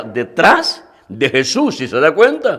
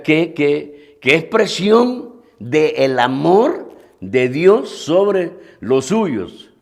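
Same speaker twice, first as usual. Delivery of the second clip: ordinary delivery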